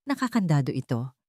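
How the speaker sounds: usual speaking voice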